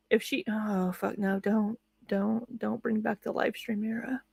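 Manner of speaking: soft exasperated pleading; quietly begging no. Real, not acted. Pleading quietly against streaming.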